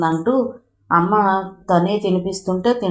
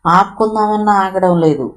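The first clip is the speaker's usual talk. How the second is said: ordinary delivery, nothing marked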